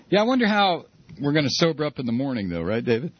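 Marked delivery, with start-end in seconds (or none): none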